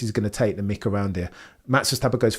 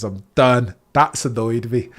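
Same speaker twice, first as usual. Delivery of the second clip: ordinary delivery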